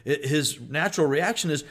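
normal talking voice

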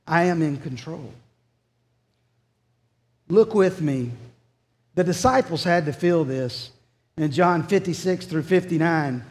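ordinary delivery

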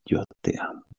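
soft, intimate declaration of love; inner voice, hushed. Retold or imitated. God's voice inside him telling Adrián of love, hushed. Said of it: "Así decía".

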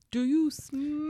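slow, drawn-out wrestler taunt; The Rock catchphrase. Retold or imitated. Friends doing The Rock, a slow wrestler taunt. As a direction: stretch it out like a ring taunt. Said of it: "do The Rock".

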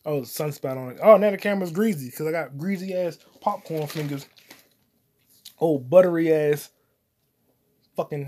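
ordinary talk, nothing marked

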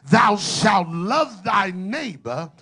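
preaching at full force. Acted. Preacher reading Galatians to his congregation, full preaching force. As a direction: proclaim each phrase like thunder from the pulpit.

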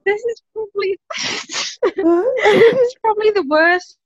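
helpless embarrassed laughter; laughing. Real, not acted. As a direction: struggle to talk through real giggles. Laughing while confessing an awkward moment.

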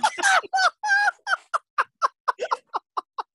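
long high helpless laughter; dissolving into laughter; high breathless laugh. Real, not acted. Cracks up at own story.

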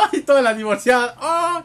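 loud, high laugh-filled cry; squealing with laughter. Real, not acted. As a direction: shout it through laughter. Laughing at the movie.

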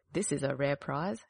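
a hint of a greedy old woman.

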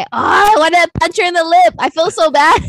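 high, loud burst of annoyance; worked up and comically frustrated; playful exasperation. Real, not acted. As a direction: vent loudly, half-joking. Playful exasperation at actress's lip.

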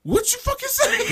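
very high-pitched mock outrage; hammed-up indignant challenge; outraged comeback. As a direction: fire back in incredulous high-pitched anger. Imitating friend's outraged comeback.

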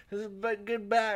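high, slow put-on voice for a talking mouth; squeaky mouth character, drawn out; mafia mouth voice. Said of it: "the mafia's guy's mouth is like".

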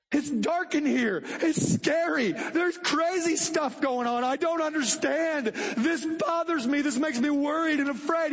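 put-on high, slow scared little kid voice; child afraid of the dark, speaking to his daddy; frightened child. Voicing frightened child to God.

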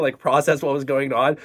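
usual speaking voice

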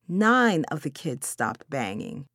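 usual speaking voice

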